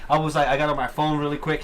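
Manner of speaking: usual speaking voice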